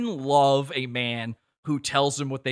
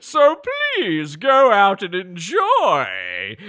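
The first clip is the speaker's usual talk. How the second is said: put-on loud, slow wobbly old doctor voice; a quavering public announcement, hammed up; warbling mock official message; Fauci voice clip; 4 semitones higher, 9 dB louder, much slower than usual. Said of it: "Is that what Doctor Fauci sounds like?".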